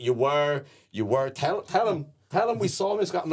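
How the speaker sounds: high, pleading tipsy voice; mock drunk woman urging her daughter; insistent drunk fan. Drunk mother urging her daughter to back her up, high and pleading. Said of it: "She's like".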